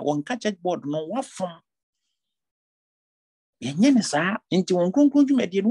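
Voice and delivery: fervent sermon exhortation; full-force preaching. Acted. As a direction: drive the warning home from the pulpit. Pastor exhorting church board members.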